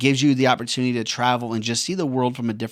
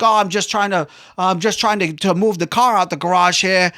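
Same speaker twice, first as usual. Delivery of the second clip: higher, louder mock local voice; imitated snow-shoveling local; 6 semitones higher, 5 dB louder than usual. Local shoveling snow by the garage, mock-casual and loud. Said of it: "people out here walking around".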